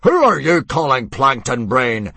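loud, outraged bellow; furious shout. Acted. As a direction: roar back at the insult. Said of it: "demanded Mogadon".

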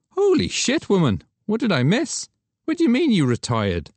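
high, loud disbelieving outburst; shocked Douglas. Acted. Photographer hearing his star model retired, loud disbelief. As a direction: cry out in shock.

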